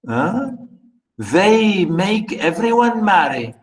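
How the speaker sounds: ordinary talk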